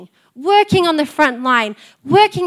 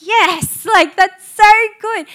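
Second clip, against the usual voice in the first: bright high delight, rising louder; 5 semitones higher, 5 dB louder, slower than usual. Retold or imitated. Mum proud of her kids, gushing delight. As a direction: gush with proud joy. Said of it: "But then I'm like".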